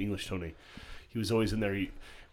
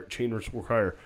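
normal talking voice

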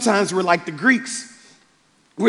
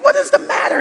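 much higher imitation of a concerned woman; Heidi; 3 dB louder than usual. Heidi to the bawling pastor at night, high-pitched concern. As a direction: ask it in a high-pitched worried voice. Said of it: "Heidi's like".

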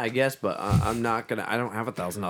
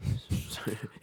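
soft laugh; 6 dB quieter than usual. Real, not acted. Quiet laugh after question.

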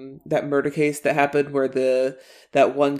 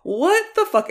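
much higher, stunned disbelief; incredulous. Real, not acted. Reacting to the finale, high-pitched disbelief. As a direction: let the pitch jump up in shock. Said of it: "the series just ends like that".

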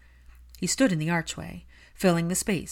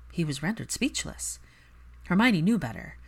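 plain reading voice

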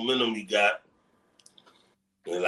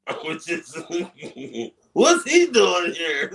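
laughing out loud, higher and louder; can't get the words out for laughing; real laughter, slow and disbelieving; 4 semitones higher, 4 dB louder, slower than usual. Real, not acted. Laughing at co-host's idea.

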